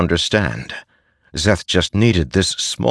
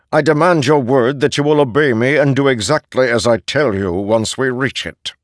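lightly voiced, higher herald issuing orders; calm cold authority from an immortal; a hint of a herald's command; 7 semitones higher, 3 dB louder than usual. A narrator's reading. Nin, herald commanding Szeth.

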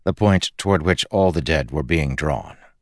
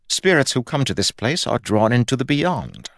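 higher-pitched, insistent god voice; exasperated Fuzz; 7 semitones higher than usual. Acted. God explaining death to a stubborn spirit, exasperated. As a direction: explain the rule in a higher, put-upon voice.

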